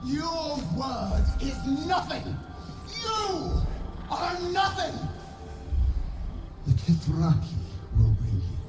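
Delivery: high furious shouted threats. Acted. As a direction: scream threats of devouring. Queen threatens, game scene.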